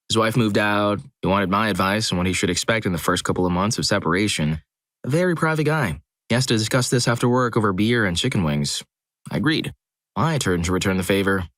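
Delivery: ordinary delivery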